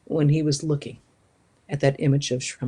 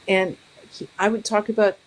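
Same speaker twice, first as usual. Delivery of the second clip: ordinary delivery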